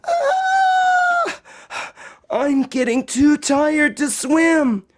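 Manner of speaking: high, scared cry then slow worry; Josephine frightened and tired. Acted. Girl chased in the lake, high and frightened. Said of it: "thought Josephine".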